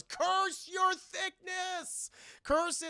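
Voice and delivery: high, drawn-out villain screech; hammed-up shrieking villainess; villainous curse. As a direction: wail the curse slowly, high and theatrical. Acting out defeated Demona cursing.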